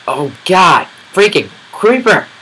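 high, loud frightened yell; real fright shout; panicked shriek. Real, not acted. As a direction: scream it at the creeper. Creeper surprise attack.

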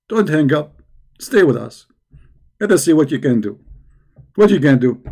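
normal talking voice

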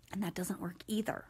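unvoiced whisper; hushed aside. Real, not acted. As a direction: drop to a breathy whisper. Aside about being pissy.

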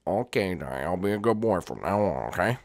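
put-on low, soft childish Jeffy voice. Jeffy answers his parent, low sulky kid voice. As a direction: mumble it like a stubborn kid.

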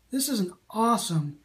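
ordinary talk, nothing marked

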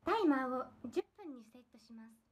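low, even Siri voice; Siri impression. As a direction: read it like a voice assistant. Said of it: "シリのモノマネって".

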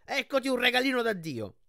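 high, loud mocking shout; shouted taunt. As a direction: hurl it like a gift-wrapped threat. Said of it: "gridò Beckendorf".

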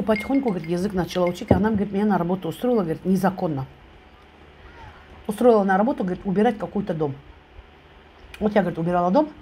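chewing between words; talking while eating. Real, not acted. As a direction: food near the mouth. Seen 3: holds drumstick, chews between phrases, bites near 3.4s.

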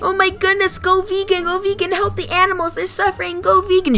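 a youtuber mocking the vegan teacher's pleading. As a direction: parody a preachy vegan in a squeaky voice.

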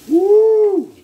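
a loud, high whoop of joy. Real, not acted. Cook celebrating his cooking with a loud whoop. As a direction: let out a big whoop. Excited whoop over food.

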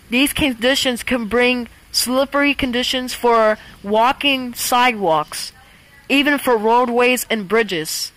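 loud, measured emergency broadcast read; weather alarm voice. Acted. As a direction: announce the storm like an automated alert. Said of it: "the voice just sounds like me".

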